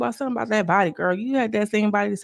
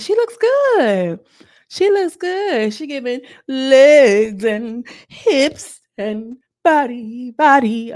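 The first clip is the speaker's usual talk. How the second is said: hyped praise; 5 semitones higher, 7 dB louder, much slower than usual. Real, not acted. Hyping the contestant's entrance.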